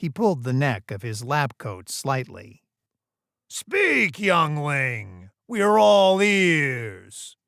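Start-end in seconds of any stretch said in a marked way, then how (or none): 3.5-7.4 loud, high, drawn-out call to speak; mocking boss. Boss prodding a young employee to speak up, mocking and loud. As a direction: lord it over the youngling, stretching the words out loud.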